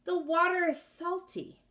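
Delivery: surprised. Acted. Character spits out salt water.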